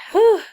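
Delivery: sudden loud puff, then whew; real burst of breath, feeling poorly; unwell sigh. Real, not acted. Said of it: "[name]'s not feeling good".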